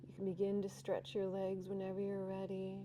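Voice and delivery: soft, unhurried breathwork guidance; guided breathwork. Acted. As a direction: guide the class gently into the stretch. Leads breathing and relaxation.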